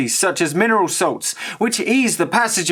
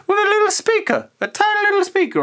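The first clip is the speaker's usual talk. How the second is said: much higher, fast, delighted; excited high voice; faster than usual. Real, not acted. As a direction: gush over the tiny speaker. Delighted at the tiny speaker.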